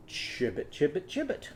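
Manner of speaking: first Chibbit hushed and breathy, then croaked; soft mock frog croak. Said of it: "what's Chauncey's frog gonna do?".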